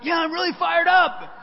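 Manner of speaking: high, loud overeager "Yeah, I'm really fired up"; forced eager yell. Retold or imitated. Young song leader insisting to the leader, in a high loud yell. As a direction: shout it to prove you mean it.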